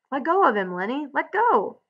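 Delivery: high, urgent order to release; urgent shout. A narrator's reading. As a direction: call out high, trying to stop him. George runs to stop Lenny.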